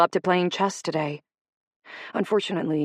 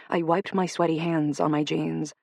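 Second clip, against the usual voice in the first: plain reading voice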